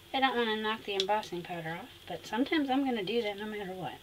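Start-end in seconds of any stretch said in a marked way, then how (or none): none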